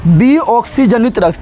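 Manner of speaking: विऑक्सीजनित said slowly and clearly; dictating विऑक्सीजनित to students; slow new term. Shown as a demonstration. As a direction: lay out विऑक्सीजनित syllable by syllable. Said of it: "teacher introduces new term".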